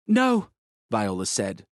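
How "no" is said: a touch of a trembling no; shaky, high refusal. A narrator's reading. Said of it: "her shaky voice".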